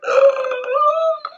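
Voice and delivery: sharp, very high gasp of delight; excited gasp. Retold or imitated. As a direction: gasp like you just found a favorite.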